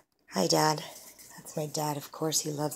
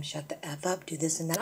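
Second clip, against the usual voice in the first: usual speaking voice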